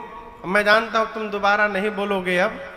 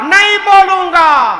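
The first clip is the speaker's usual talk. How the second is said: loud, high defiant refusal; defiant shout; 10 semitones higher, 9 dB louder than usual. Acted. Atheist son to the guru, shouting defiance. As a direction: yell the refusal back at the guru.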